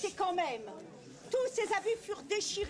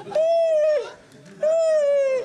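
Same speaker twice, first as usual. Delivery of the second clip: ogre laugh; 8 semitones higher, 10 dB louder, much slower than usual. Acted. Ogress laughing loudly.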